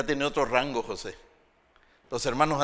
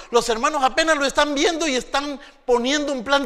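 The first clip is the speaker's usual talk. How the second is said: high, loud preaching; full-force preaching; 10 semitones higher, 10 dB louder than usual. Acted. Preacher telling the church about Joseph. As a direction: drive the story home to the crowd.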